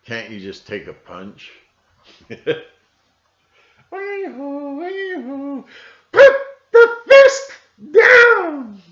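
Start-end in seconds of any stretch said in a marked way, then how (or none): 2.3-5.6 high, far-off whooping "wee-hoo"; mock cheer. 6.1-8.8 very high, loud yelled command; mock shout.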